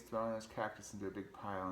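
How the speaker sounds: ordinary delivery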